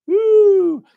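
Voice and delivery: a loud, high mock cheer; mock whoop. Speaker mocks selfish earners with a loud high whoop.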